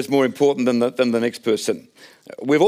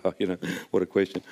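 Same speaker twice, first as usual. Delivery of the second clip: low, softer eye-rolling groan; sarcastic boys rolling their eyes; a touch of teen sarcasm, low and soft; mock exasperation; 8 semitones lower, 9 dB quieter, faster than usual.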